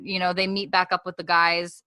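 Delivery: usual speaking voice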